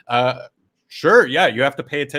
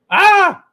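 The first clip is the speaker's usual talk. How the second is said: loud high-pitched startled "ah!"; high yelp at a sudden noise; startled yell; 8 dB louder than usual. Real, not acted.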